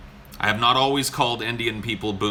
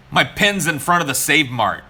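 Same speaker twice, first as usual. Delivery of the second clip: louder, stressing My; emphatic; 5 semitones higher, 6 dB louder than usual. Retold or imitated. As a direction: insist on My, louder. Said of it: "And I was like".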